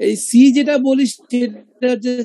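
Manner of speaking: slow explanation. Shown as a demonstration. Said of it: "explaining the sound".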